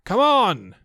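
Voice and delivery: high loud call. A narrator's reading. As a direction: shout it up the line. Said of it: "called Pooh and Piglet".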